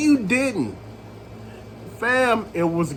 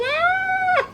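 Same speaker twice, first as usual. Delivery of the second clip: very high drawn-out mock scream; mimicked scream. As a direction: imitate her screaming, pitch shooting way up. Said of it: "Just screaming".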